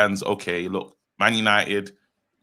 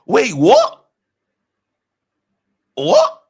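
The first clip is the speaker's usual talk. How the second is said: shocked disbelief; 10 dB louder, much slower than usual. Real, not acted. Shocked at the manager's quote.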